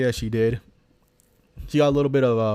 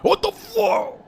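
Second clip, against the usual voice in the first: startled shriek; much slower than usual. Real, not acted. Jump scare reaction.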